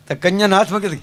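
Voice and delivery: usual speaking voice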